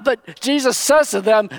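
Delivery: usual speaking voice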